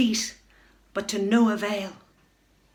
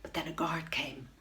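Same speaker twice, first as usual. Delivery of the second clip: whispered, tense and quiet; hushed narration; 3 semitones lower, 12 dB quieter than usual. A narrator's reading. Narrator hushes as a guard approaches, whispering. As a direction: hold your breath as the guard comes.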